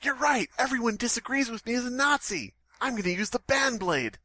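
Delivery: high-pitched cartoon hero voice; Sora as CEO. Twitter CEO Sora threatening to ban users, high cartoon voice. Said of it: "picturing the C E O of Twitter as just Sora".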